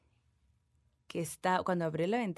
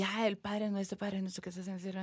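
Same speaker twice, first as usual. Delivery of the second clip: fast, softer mumbled Lord's Prayer; rushed prayer; 7 dB quieter than usual. Retold or imitated. Frightened mother praying fast at the ghost. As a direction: gabble the prayer in fear. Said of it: "empieza a rezar más rápido".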